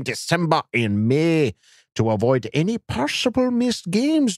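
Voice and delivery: high-pitched, hammed-up German accent. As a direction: read it grandly as a mock German. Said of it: "requested that I use my German voice".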